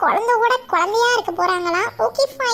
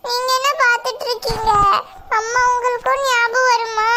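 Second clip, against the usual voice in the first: normal talking voice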